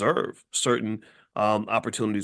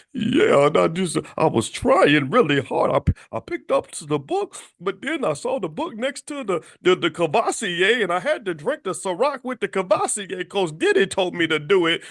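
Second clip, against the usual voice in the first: mock excuse; 7 semitones higher, 3 dB louder than usual.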